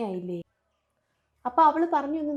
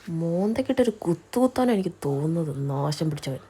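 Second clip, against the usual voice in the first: grumbling curse; 7 semitones lower, 5 dB louder than usual. Acted. Muttered curse at staring man.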